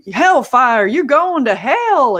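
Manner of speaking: hellfire preacher with a heavy Southern drawl, loud and high; heavy Southern accent. Retold or imitated. YouTuber mocking Southern preachers on TV, heavy Southern accent. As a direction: draw out the words like a Southern fire-and-brimstone preacher. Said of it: "you get this really heavy Southern accent".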